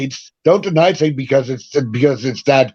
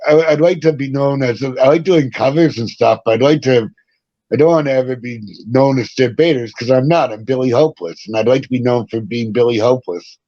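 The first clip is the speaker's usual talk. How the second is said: ordinary delivery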